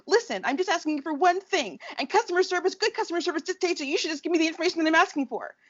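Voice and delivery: imitating a demanding customer; Karen voice. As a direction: demand to speak with the manager. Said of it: "that is when my Karen came out".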